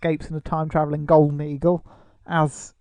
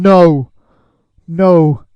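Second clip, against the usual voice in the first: heroic shout; 8 dB louder, much slower than usual. Retold or imitated. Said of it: "helmet off and gone".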